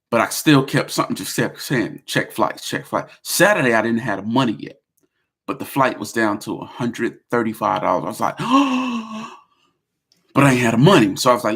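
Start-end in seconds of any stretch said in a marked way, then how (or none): 2.0-3.1 a hushed voice in his head repeating itself; whispered inner voice. Retold or imitated. His inner voice nagging him in a whisper. 8.4-9.3 sharp high surprised gasp. Retold or imitated. Seeing the flight price drop, a sharp gasp.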